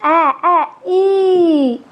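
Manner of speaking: ए and ई drawn out, clear; sounding out the vowel. Shown as a demonstration. Teacher sounding out the vowel in egg. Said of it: "यहाँ देखिए ई".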